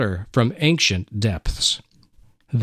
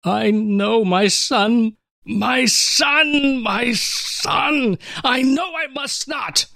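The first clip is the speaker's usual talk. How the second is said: anguished crying; 9 semitones higher, slower than usual. Acted. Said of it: "Now the tears would not stop".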